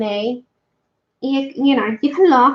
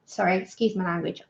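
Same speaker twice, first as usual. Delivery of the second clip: normal talking voice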